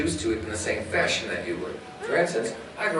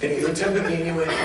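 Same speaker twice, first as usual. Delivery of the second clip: usual speaking voice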